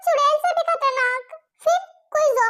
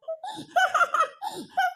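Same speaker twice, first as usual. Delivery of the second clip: soft higher giggle; giggling at the groom's brother; laugh; 5 semitones higher, 5 dB quieter than usual. Acted. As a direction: giggle lightly at his shock. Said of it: "आप इतना हंस क्यों रहे हो".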